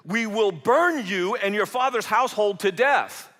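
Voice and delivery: loud, high threat to burn her family; menacing threat. Acted. Philistine men threatening Samson's wife, loud menacing threat.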